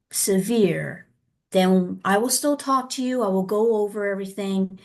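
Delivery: usual speaking voice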